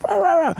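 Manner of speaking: high-pitched "rah, rah, rah" noise; silly high roar; mock roaring. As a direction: imitate a ranting roar in quick high bursts. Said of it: "guy comes down and is like".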